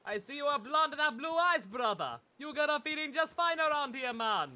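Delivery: put-on teasing Jamaican accent aimed at the blonde hero; mock island slang. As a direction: play the laid-back islander calling you brother. Mimicking the character's island slang.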